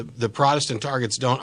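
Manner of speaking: ordinary talk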